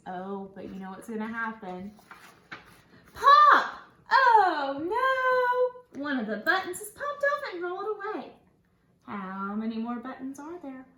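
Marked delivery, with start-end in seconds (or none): 3.1-3.8 high, loud exclaimed pop; sudden loud pop. Acted. Button pops in the story. 4.1-8.3 high, loud oh no then quick telling; hammed-up storybook alarm; mock dismay. Dramatic storybook reaction.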